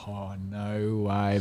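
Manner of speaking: hushed, drawn-out disbelief; stunned disbelief. Retold or imitated. Player retells learning he may be in, stunned disbelief.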